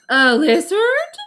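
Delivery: loud, slow, high disbelief; incredulous with a hint of disgust; disbelieving. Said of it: "a mix of disbelief and mild disgust".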